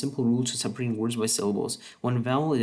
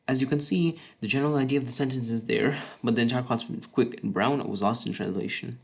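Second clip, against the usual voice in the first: usual speaking voice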